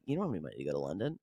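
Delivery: a deep, dry impression of the boyfriend; imitating boyfriend. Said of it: "he was like".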